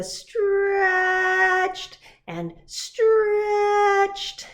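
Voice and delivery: storyteller stretching the words; stretched out. A narrator's reading. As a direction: pull the words long like reaching up. Narrator acts out the climbing stretch.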